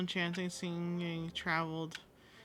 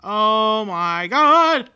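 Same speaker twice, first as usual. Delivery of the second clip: drawn-out alarmed shout; loud exclamation; 3 semitones higher, 15 dB louder, much slower than usual. Real, not acted. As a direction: wail it out slowly in shock. Alarmed in game.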